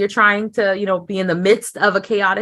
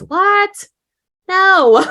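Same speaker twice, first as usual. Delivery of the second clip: high-pitched, disbelieving exclamation; incredulous outburst; 11 semitones higher, 6 dB louder than usual. Acted. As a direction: react in mock shock, high and drawn out. Mock shock at absurd belief.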